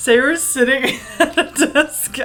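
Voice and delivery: narration broken by real laughter; laughing while reading. Real, not acted. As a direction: try to read on while cracking up. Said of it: "I'm laughing at this".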